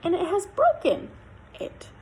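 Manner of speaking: high, fast squeaky little bear; squeaky baby voice. A narrator's reading. Little Bear finds his chair broken, squeaky baby voice. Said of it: "said in a squeaky baby voice".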